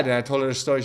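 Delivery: normal talking voice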